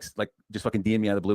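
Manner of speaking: ordinary talk, nothing marked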